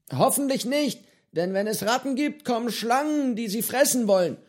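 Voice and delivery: put-on a cheerful eccentric old man offering fish; old pirate Johnny. Old pirate cook to hungry boy, cheerful and high.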